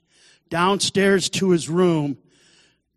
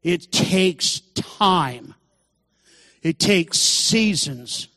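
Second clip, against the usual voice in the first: "takes" drawn out, each word spaced very slowly; preacher leans on every word, slowly; slow emphasis; much slower than usual. Real, not acted. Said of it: "It's not thirty seconds done and eat".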